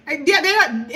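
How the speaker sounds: usual speaking voice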